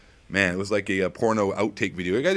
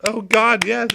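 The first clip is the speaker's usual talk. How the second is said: much higher, louder cheering voice; mock cheerleader; 13 semitones higher, 4 dB louder than usual. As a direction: cheer like an over-eager fan. Cheering himself in another voice.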